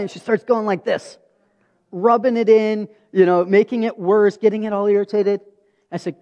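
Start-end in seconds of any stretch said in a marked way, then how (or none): none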